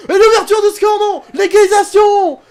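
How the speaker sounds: very high, very loud, stretched goal scream; real, laughing goal yell at the screen; goal celebration shout. Real, not acted. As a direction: roar it like a commentator for the equaliser. Celebrating an equaliser in play.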